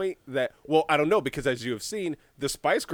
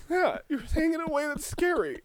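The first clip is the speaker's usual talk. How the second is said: much higher, nervous complaint; mock spooked; 11 semitones higher than usual. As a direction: act thrown by her calm menace. Dictator spooked by her tone.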